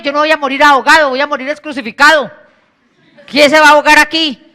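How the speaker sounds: a scolding preacher's bellow; mock stern shout. Jesus shouts at frightened disciples in the storm, loud rebuke.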